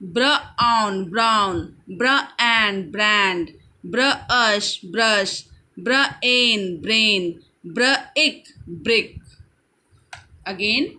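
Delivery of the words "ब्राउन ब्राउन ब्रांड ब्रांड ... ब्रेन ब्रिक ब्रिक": slow phonics drill, ब्र, ending, then whole word as in ब्रेन; slow, segmented word-building from ब्र; sounding out blends. Shown as a demonstration. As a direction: slowly split ब्राउन into ब्र and आउन, then say it whole. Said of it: "B आर का मिल करके बन जाता है ब्र".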